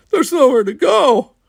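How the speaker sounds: very high, loud tearful wail. A narrator's reading. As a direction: wail it in despair. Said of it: "he was sobbing".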